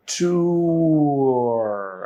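"Tour" stretched out into a long "too-er"; very slow demo. Shown as a demonstration. Teacher slowing the word for learners, very slow. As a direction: draw out both vowel sounds in "tour". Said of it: "আমি যদি ট্যুরটাকে অনেক slow বলি".

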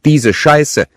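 high, loud outburst of disgust; exasperated sister swearing; angry cursing. A narrator's reading. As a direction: spit out the curse at full volume. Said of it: "fluchte sie".

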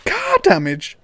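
high, loud incredulous question; car damage, he can't believe it; shocked disbelief. Real, not acted. Gamer rereads the charge, high and shocked. Reads fee, then repeats amazed.

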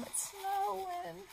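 high, slow, wide-eyed delight; delighted singsong. Acted. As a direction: coo it like a child seeing snow. Shaking the snow globe, delighted.

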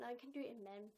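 usual speaking voice

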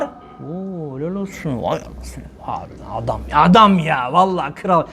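mock awed praise. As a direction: breathe it out like a dazzled admirer. Imitating fans praising a sinner.

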